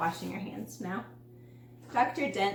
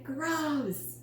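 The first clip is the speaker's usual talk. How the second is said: a higher, grossed-out little gross; disgusted; 5 semitones higher than usual. A narrator's reading. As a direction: say it with a crinkled nose. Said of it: "crinkled his nose and said".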